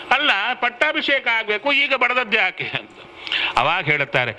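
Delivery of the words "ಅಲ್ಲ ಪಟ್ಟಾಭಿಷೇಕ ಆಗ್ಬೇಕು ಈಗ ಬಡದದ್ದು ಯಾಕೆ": high-pitched acted questioning; bewildered protest. King asks minister why he was struck, bewildered protest. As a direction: play baffled objection, voice pitched well up.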